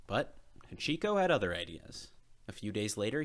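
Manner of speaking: usual speaking voice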